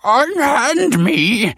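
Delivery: very high, drawn-out loud cry; slow, piercing scholarly protest; indignant cry. A narrator's reading. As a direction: cry out in outrage at being grabbed. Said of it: "cried the scholar".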